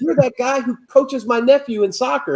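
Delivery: cheerful mock of the fan's punchline; stranger's reveal. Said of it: "And he's like".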